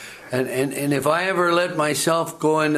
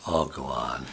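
mock modesty; 10 semitones lower, 7 dB quieter than usual. Bashful reply to praise, acted.

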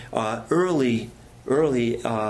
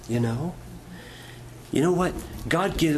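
ordinary delivery

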